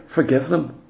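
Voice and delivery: low, hushed plea; a quiet pastoral appeal to forgive; soft urging. Real, not acted. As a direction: press it gently and softly. Preacher urging the saints softly.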